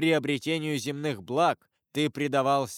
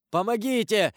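high, louder desperate cry; cry for help; 8 semitones higher, 4 dB louder than usual. A narrator's reading. Wounded Caderousse calls for help, high desperate shout. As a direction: shout Помогите! for your life. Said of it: "закричал Кадрус".

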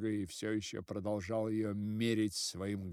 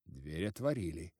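usual speaking voice

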